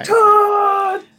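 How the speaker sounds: very high, loud drawn-out holler; piercingly high greeting yell; excited shout. Acted. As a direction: scream a friend's name with joy. Player character calls to Todd running.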